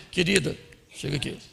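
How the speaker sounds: low, soft, matter-of-fact husband; man discussing a problem. Acted. Husband telling wife money is short, low-key and serious. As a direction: confide quietly, in a subdued low voice. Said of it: "Homem vai falar de um problema".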